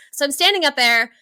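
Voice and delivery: ordinary talk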